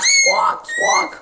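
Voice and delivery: very high squawking; parrot squawk. Acted. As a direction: screech like a parrot. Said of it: "The parrot began to squawk".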